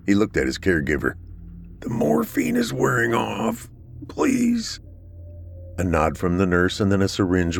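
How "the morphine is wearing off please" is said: high, strained plea; pained pleading. Acted. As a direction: beg through the pain. Inmate in pain begs nurse.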